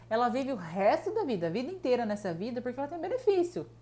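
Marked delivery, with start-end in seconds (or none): none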